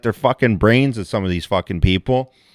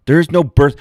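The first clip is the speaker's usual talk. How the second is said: ordinary talk, nothing marked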